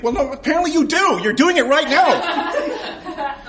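mocking retort. Narrator mocking the huffy customer, high and slow. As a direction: drag out the put-down.

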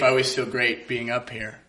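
usual speaking voice

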